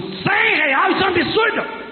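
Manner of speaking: high indignant disbelief at the price; shocked at price. Retold or imitated. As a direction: balk at the cost, outraged. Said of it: "Ele falou".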